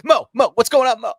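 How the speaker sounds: high, loud, manic host; Mike O'Malley. Acted. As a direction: fire it off like a wired host. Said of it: "he was just coked up".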